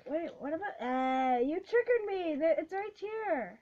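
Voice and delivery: high, frantic outburst; loud and rattled, hunting for it; agitated, loud. Real, not acted. Frantically searching the pack.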